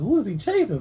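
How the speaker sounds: puzzled. Retold or imitated. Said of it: "And I'm looking".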